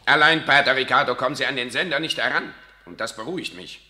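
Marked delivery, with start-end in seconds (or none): 0.0-2.5 sharp outburst. Acted. Monsignore confronts priest heatedly.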